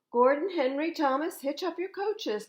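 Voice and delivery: high, loud call to the engines. A narrator's reading. Sir Topham Hatt calls the engines to hitch up, loud and high. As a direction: summon the engines loudly across the yard. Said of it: "called Sir Topham Hatt".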